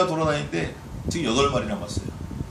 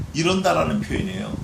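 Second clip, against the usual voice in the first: normal talking voice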